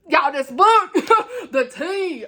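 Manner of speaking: high, loud dishing to friends; mock outrage. Reader venting to her crew in the chat, mock outrage. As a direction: blurt it out like scandalous gossip.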